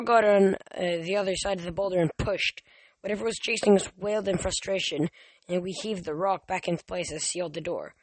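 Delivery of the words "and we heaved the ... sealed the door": ordinary delivery, nothing marked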